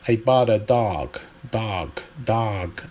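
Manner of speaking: American "dog" with an ah vowel; American accent. Shown as a demonstration. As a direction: open the o in "dog" to ah, American style. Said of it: "a for o".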